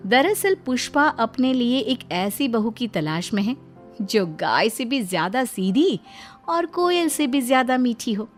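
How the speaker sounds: usual speaking voice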